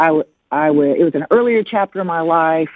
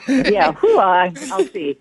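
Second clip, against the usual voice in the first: nervous laugh; 3 semitones higher, slower than usual. Real, not acted. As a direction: laugh awkwardly, stalling. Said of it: "That's an uncomfortable laugh".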